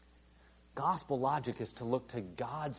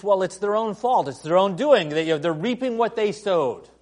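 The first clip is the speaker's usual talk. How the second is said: loud, high self-righteous scoff; hammed-up indignant blame; mock smug excuse; 7 semitones higher, 10 dB louder than usual. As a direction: sneer it like a judge.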